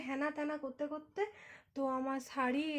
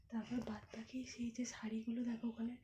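whisper; 2 semitones lower, 7 dB quieter than usual. Real, not acted. Mother whispering to viewers while the baby sleeps. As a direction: whisper it under your breath. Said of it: "আস্তে আস্তে কথা বলছি".

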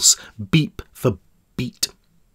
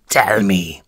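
low menacing whisper; whispered warden; 5 semitones lower than usual. Acted. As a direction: breathe it in his ear. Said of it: "he whispered".

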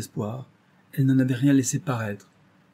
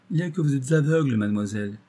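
a touch of stern doctor, quick and higher at first; 4 semitones higher, 3 dB louder, faster than usual. A narrator's reading. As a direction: deliver the blunt verdict firmly. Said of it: "dit sévèrement le docteur".

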